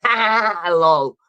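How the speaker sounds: playful put-on cackle; mock laugh. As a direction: ham up a silly laugh.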